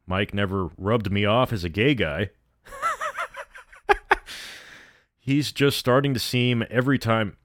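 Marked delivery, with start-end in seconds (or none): 2.7-3.6 short high-pitched laugh. Real, not acted. Storyteller amused mid-story, brief high laugh. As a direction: let out a small, high laugh.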